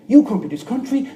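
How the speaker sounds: mock angry yell. Quoting a woman harassing him.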